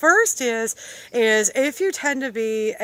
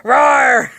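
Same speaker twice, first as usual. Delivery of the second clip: loud playful roar; big hammed-up growling roar; mock angry roar; 3 semitones higher, 10 dB louder than usual. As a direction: roar it out like a pretend beast. Mock roar after angry-voice demo.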